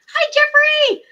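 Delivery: high, loud cheery hello; excited hello to a viewer; bright greeting. Real, not acted.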